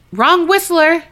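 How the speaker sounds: high, loud call out; loud announcement. Retold or imitated. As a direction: announce it loudly and nervously. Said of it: "I loudly announced".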